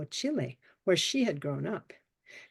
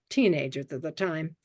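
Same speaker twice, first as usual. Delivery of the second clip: ordinary delivery, nothing marked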